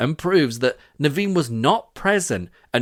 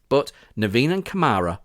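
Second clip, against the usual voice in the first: ordinary talk, nothing marked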